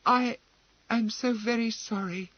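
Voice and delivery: high, timid apology; a frightened girl near tears; Emily apologising to Gerard, trembling. A narrator's reading.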